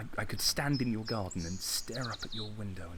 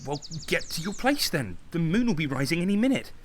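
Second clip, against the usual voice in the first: fast, higher, louder bossing; brisk loud orders; 6 semitones higher, 4 dB louder, faster than usual. Acted. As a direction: rattle off orders like a villain's boss. Boss giving orders to the henchman.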